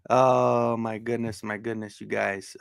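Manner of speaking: normal talking voice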